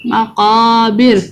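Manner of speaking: slow, drawn-out tajweed recitation; Quran recitation. Shown as a demonstration. Teacher reciting the surah for children to follow, slow chanted recitation. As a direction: chant each word slowly for children to repeat.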